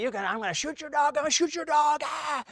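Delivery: high, fast yelled threat; imitating a hollering neighbor. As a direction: mimic an angry neighbor shouting over the fence. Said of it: "hollering at me".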